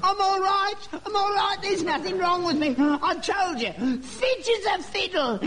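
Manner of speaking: high, excited crowing; elated relief. Acted. Learns the bad letter isn't his.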